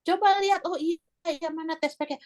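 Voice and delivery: high-pitched imitation of eager sibling; excited sibling, retold. Sibling asking to see the test, high eager retold voice. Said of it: "kakak saya bilang".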